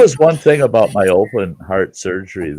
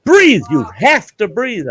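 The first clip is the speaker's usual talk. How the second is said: higher, urgent mock shout; retold nurse screaming; 9 semitones higher than usual. Nurse screaming at the patient, higher mock shout. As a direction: insist he breathe, pitched up. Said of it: "the one nurse is screaming at me".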